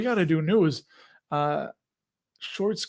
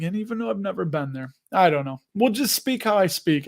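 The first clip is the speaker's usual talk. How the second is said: ordinary talk, nothing marked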